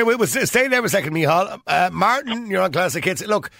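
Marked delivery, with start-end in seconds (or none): none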